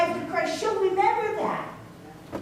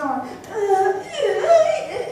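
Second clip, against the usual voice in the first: rising mock moans of pain, the last much higher and louder; hammed-up whimpering uh sounds from a hurting lady; imitated pained groans; 4 semitones higher, 4 dB louder, much slower than usual. Preacher imitates the crying woman in the store aisle, mock groaning. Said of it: "and she was going".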